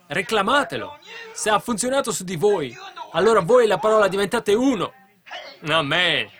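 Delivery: high, building to loud exhortation; rally the congregation with mounting force; full-force preaching. Real, not acted. Preacher urging believers to claim the promise, full-force exhortation.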